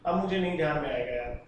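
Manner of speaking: low, soft giving up; resigned admission. Real, not acted. Teacher admitting to students the name escapes memory, quiet resignation. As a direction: let it sink down quietly.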